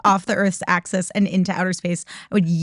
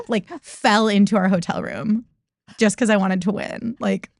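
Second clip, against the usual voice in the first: normal talking voice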